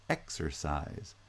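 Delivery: Exercise slow and soft, EX-er-CISE; first and third stressed. Shown as a demonstration. Teacher repeating the example, slow Exercise stressed on first and third syllables. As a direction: slowly give Exercise two stresses with a weak middle. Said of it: "both the first and the third syllables are stressed".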